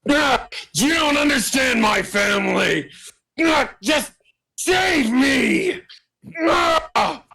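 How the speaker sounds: very high, strained cries; desperate pleading. Acted. Grenlich swarmed and begging to be saved, high desperate cries. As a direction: shriek it like someone being torn at.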